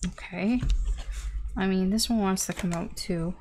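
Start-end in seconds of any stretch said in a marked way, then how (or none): none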